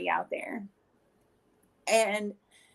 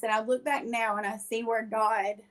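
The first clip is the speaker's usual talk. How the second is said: usual speaking voice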